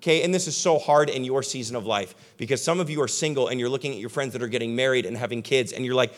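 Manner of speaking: ordinary talk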